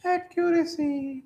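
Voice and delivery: very high, long drawn-out word; playful falsetto dictation. Real, not acted. Teacher dictating a definition to students, slow playful falsetto. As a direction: stretch it slowly in a falsetto.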